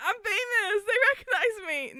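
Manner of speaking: high laughing mock brag; mock vain. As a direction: brag it through tears of laughter. Mock vain quote while laughing.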